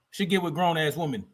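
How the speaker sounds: usual speaking voice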